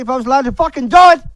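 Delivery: enraged outburst; sudden furious shout. Real, not acted. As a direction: erupt into a yell mid-sentence. Violent rant at critics.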